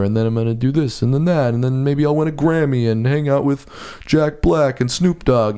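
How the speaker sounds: put-on dreamy Brooklyn accent boast. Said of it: "this is my Brooklyn accent".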